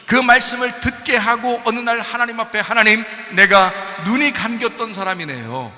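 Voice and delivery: shouted, high-pitched sermon climax; a preacher shouting to the congregation at the climax; fiery preaching. Real, not acted. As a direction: preach at full force to the congregation. Preacher rises to full force.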